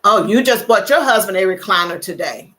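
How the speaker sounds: normal talking voice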